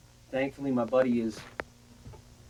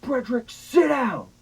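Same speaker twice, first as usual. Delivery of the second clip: furious shout; 25 dB louder, slower than usual. Retold or imitated. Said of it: "heard my mom say those words".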